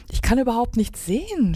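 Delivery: high cry without much volume; mock cry of alarm. A narrator's reading. Pip flies blind at night, crying out in alarm. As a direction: cry out in a storybook panic. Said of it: "schrie Pip".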